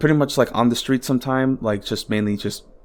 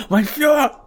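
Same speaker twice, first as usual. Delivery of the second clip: high, squealing mock fanboy cry, slow on the second; mocking Musk; much slower than usual. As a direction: ham up a giddy, drugged-out Musk. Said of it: "it's like".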